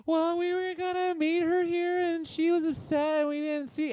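mock falsetto girlfriend making excuses; girlfriend lying. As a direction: spin a lie in a squeaky falsetto. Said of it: "just starts lying".

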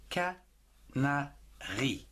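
"Canari" very slowly split into ca, na, ri; syllable by syllable. Shown as a demonstration. Teacher sounding out a word for learners, "Canari" in three slow syllables. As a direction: break "Canari" into three separate beats, ca - na - ri. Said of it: "En trois syllabes".

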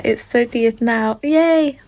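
normal talking voice

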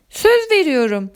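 tiz, iç çeker gibi söz; hafifçe iç geçirerek, ince sesle; iç çekerek. A narrator's reading. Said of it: "içini çekerek". (içini çekerek söz verme)

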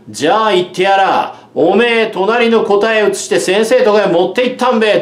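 bully smugly accusing Kozo of copying; smug accusation. A narrator's reading. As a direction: lay the charge on with a sneer.

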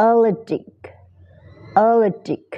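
allergic said high with a light g; allergic demo. Shown as a demonstration. Teacher modelling allergic from the IPA. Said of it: "allergic ตามนี้เลยนะ ไอพีเอ".

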